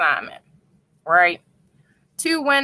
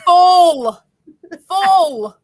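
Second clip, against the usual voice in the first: loud, higher drawn-out "Full"; mock accented echo; 6 semitones higher, 6 dB louder, much slower than usual. Teasing echo of accented word.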